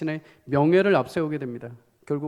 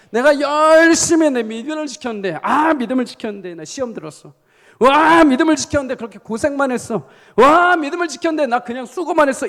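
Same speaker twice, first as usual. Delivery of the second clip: high, loud mock complaint of a worn-out believer; loud lament; 9 semitones higher, 9 dB louder than usual. Preacher voicing believers who kept faith only to suffer, loud lament. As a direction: wail it out with big drawn openings.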